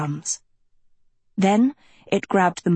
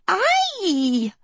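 high, wailing yelp of pain; braying cry; 11 semitones higher than usual. A narrator's reading. Sophie brays as Agatha bites her hand, high pained cry. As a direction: bray out a high held cry. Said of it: "Sophie brayed".

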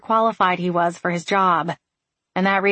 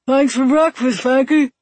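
called out, higher and louder, mouth full; calling with mouth full; 7 semitones higher, 6 dB louder than usual. A narrator's reading. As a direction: shout it through a mouthful of food. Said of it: "Landry called around the food in his mouth".

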